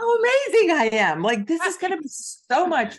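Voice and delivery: very high, loud, laughing gush; giddy self-mockery. Author mocking her past self expecting praise, high laughing gush.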